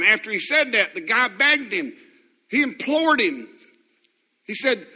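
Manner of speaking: high, loud pulpit preaching; fiery preacher in full flight; preaching at full force. Acted. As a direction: build the sermon to a loud, high climax.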